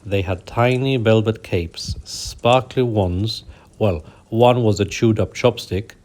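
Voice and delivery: plain reading voice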